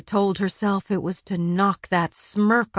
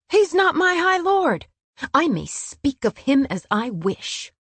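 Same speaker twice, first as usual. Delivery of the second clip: loud, high-pitched outburst; indignant raised voice; furious defiance; 7 semitones higher, 5 dB louder than usual. A narrator's reading. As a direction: burst out in angry defiance. Heated quarrel, she defies him.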